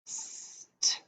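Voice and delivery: "St-" breathed out soft, no voice; blend sound. Shown as a demonstration. Teacher sounding out the blend of stump, softly breathed. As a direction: whisper the "St-" blend. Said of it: "We see a blend here".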